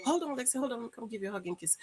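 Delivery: soft aside away from the audience; parental aside. Real, not acted. Parent asking child for a goodbye hug, softly. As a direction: step out of the show and speak quietly.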